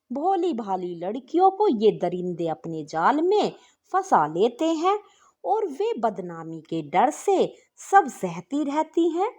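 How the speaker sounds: plain reading voice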